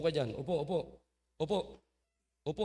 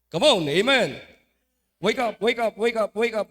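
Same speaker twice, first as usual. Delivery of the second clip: louder, insistent calling out; loud wake-up call; 2 semitones higher, 8 dB louder than usual. Real, not acted. Preacher rousing the dozing churchgoers, loud wake-up call. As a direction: rouse the sleepers in the pews.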